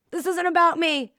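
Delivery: defensive retort. Retold or imitated. Speaker to her client, defensively indignant. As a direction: bristle and push it away.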